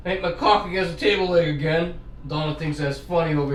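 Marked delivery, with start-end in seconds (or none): none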